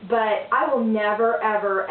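normal talking voice

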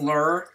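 "lure" squeezed into one short syllable, like "lurr"; corrected pronunciation. Shown as a demonstration. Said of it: "No, it's lure".

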